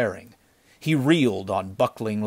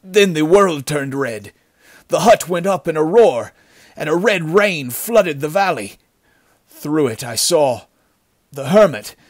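loud, higher, strained telling; horrified recounting; 6 semitones higher, 8 dB louder than usual. A narrator's reading. Wounded bandit describing the attack, horrified. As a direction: relive it in halting, rising horror. Survivor relives the horror.

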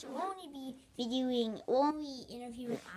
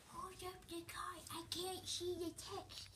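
whispering; 7 semitones higher, 10 dB quieter than usual. Real, not acted. Aside to helper off-camera.

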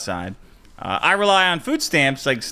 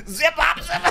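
high mock babble, half laughing; hammed-up high-pitched celebrity impression; Bill Cosby; 10 semitones higher than usual. Comic mocks the freed entertainer's reaction, high laughing impression. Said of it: "and Bill Cosby went".

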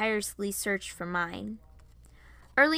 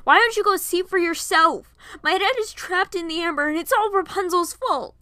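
loud, high outburst; angry yell; 10 semitones higher, 10 dB louder than usual. A narrator's reading. Varian lashes out at Piper about his trapped dad, yelling. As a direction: yell the accusation at full voice. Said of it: "he yelled".

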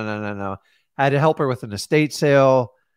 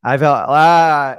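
exasperated groan; 10 dB louder, much slower than usual. Retold or imitated. Overwhelmed by screaming family.

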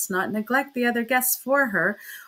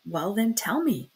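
ordinary delivery, nothing marked

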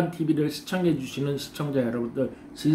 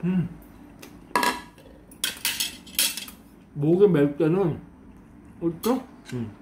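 talking while chewing food; muffled words around food; mouth full, chewing; 2 semitones higher than usual. Real, not acted. Seen 0: Bites fish cake beforehand; cheeks full, chewing throughout the words.

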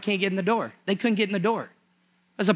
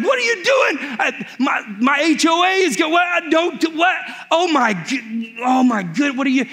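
panicked shout; 5 semitones higher than usual. Acted. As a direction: shriek at the men tearing up your roof. Peter panicking as roof torn.

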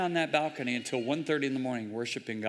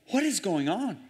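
bewildered prayer; 5 semitones higher than usual. Retold or imitated. Retold bewildered prayer to Spirit.

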